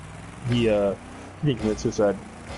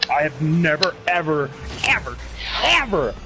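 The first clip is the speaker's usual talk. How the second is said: rising, louder, building to a laughing squeal on heard [name]; mock indignant; 4 semitones higher, 4 dB louder than usual. Real, not acted. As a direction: stretch never ever into a high laughing whine. Mock outrage over a name.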